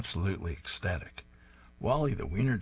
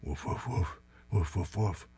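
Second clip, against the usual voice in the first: dog barking imitation. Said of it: "while barking".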